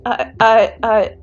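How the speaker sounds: loud flustered stammer; stuttering. A narrator's reading. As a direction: trip over the words, flustered. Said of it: "Kaminari stutters".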